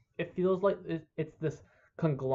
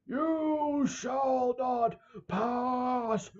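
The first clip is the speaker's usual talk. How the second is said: high, slow, drawn-out mock wizard bellow; mock booming stand against the Balrog; imitated Gandalf shout; 10 semitones higher, much slower than usual. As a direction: stretch every word out like a hammed-up Gandalf. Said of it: "and he's like".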